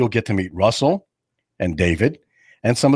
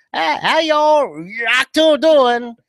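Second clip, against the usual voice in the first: high, slow mock German-Southern drawl; German Southerner; 13 semitones higher, 4 dB louder, much slower than usual. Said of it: "sounds like he's a German Southerner".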